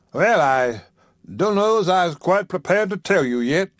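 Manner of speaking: lightly voiced preacher, playfully holding back; a hint of a coy old man; teasing preacher. Haywood teasing, holding back news.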